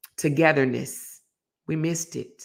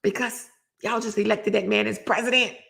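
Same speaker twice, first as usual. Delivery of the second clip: mad colonel; 5 semitones higher than usual. Retold or imitated.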